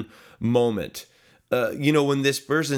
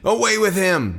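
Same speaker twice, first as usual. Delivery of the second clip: a higher, louder crowd yell; 7 semitones higher, 5 dB louder than usual. Acted. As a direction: cry it out as a hostile mob. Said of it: "they yelled".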